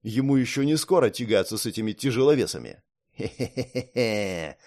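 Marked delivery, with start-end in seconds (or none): 3.2-4.6 sly snicker. Acted. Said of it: "Дальтара гаденько улыбался".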